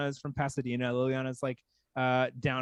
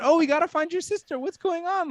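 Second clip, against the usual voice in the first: high, loud retold alarm; mock-eager, pitched way up; eager concern; 13 semitones higher, 5 dB louder than usual. Clubgoer offering to find a stranger's sister, high and eager.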